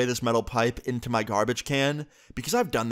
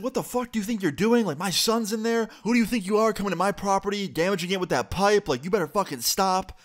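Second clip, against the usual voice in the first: angry mom; 8 semitones higher than usual. Retold or imitated. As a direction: yell at the stranger protecting your son. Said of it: "my mom and this guy yelling at each other".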